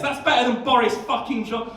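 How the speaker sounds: ordinary talk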